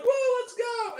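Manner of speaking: high-pitched imitated whoop; mock excited cheer. Retelling the kids on the horse, mock excited shout. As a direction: shout it like a charging rider, hammed up.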